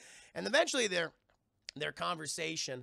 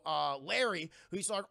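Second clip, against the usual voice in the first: ordinary talk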